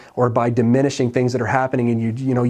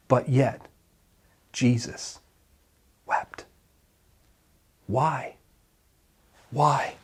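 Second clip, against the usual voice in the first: softer, dropped to near silence; hushed; 6 dB quieter, much slower than usual. Real, not acted. Preacher to grieving listeners, soft and grave. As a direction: let the words fall quietly. Somber climax of sermon.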